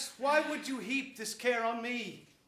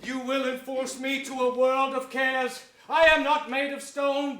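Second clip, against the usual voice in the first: louder and higher, mock-anguished; loud reluctant protest; 4 semitones higher, 7 dB louder than usual. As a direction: protest loudly while giving in. Richard accepts with feigned protest.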